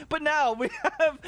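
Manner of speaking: real giggles breaking the words; giggling. Real, not acted. Can't stop giggling at the exploded player. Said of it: "I got the giggles right now".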